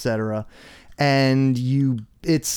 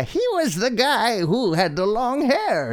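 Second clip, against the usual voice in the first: high register; 9 semitones higher than usual. Retold or imitated. Imitating voice actor's high register.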